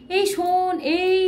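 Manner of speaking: teacher calling out to show a long vowel. Shown as a demonstration. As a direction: call someone across the yard, stretching the vowel. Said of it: "এই বলে ডাকি".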